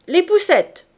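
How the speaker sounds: very loud, high command; sharp, high police shout; shouted order. A narrator's reading. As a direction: bark the order across the room. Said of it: "cria-t-il".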